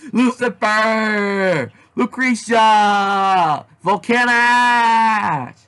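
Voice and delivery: screaming each villain's name; drawn-out scream of names. Acted. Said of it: "went on the screaming spree".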